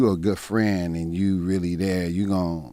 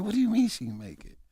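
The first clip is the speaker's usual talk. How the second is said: high, pleading disbelief; retold shocked question; disbelieving question; 13 semitones higher, 5 dB quieter than usual. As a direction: refuse to take in the news. Learning his mom died.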